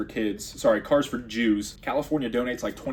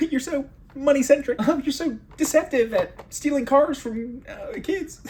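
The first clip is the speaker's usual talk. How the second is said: high, fawning chatbot flattery with a laugh; mocking AI voice; 12 semitones higher than usual. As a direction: gush like a sycophantic bot. Mocking the AI's imagined answer.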